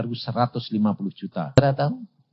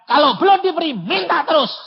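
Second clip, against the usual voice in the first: "Kalau belum diberi, minta terus" said much higher and louder; high quoting voice; 4 dB louder than usual. Shown as a demonstration. Pastor repeating the rule to hammer it home. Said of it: "Kalau dia bilang".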